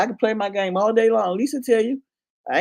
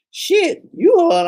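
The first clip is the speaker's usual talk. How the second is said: startled exclamation; 8 semitones higher, 7 dB louder, much slower than usual. Retold or imitated. Caught by surprise, retold.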